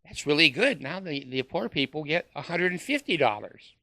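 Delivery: usual speaking voice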